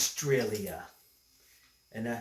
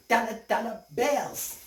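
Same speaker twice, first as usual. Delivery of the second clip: shouting; 8 semitones higher than usual. Acted. Kangaroo making it rain with cash, shouting. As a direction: call it out high like a hustler. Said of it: "he was shouting".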